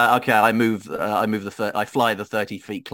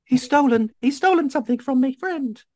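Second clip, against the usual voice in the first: very high-pitched cry for help; character shouting; 4 dB louder than usual. Acted. Adventurer alerting others to a fleeing thief, high character shout. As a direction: raise the alarm in a high character voice. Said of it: "I would also like to shout".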